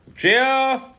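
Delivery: high, very loud summoning call; bellowing. A narrator's reading. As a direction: bellow the name through the house. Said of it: "he bellowed".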